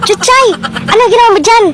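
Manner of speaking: calling out. Acted. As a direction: shout her name up high. Calling out to friend. (malakas na pagtawag)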